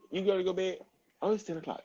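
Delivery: sing-song it up high, coaxing; high bedtime voice. Acted. To her child at ten o'clock, a high coaxing voice.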